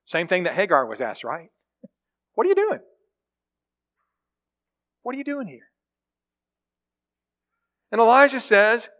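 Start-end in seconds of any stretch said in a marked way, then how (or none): 2.3-2.8 high, pointed mock-incredulous question; sharply rising what are you doing. God's question repeated with emphasis. 5.0-5.7 much softer, drawn-back repeat of the question; hushed follow-up question. Acted. Question repeated, dropping away.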